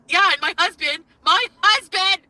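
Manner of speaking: mocking imitation, pitched high and loud; Foodie Beauty. Imitating a rival going on about her husband, mocking. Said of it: "Foodie Beauty's like".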